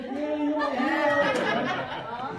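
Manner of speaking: high, loud drawn-out yeahs; mock sarcastic yeah. Wife playing along after husband finds the car, mock-loud yeahs. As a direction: sing it out big and knowing, hammed up. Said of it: "She's like".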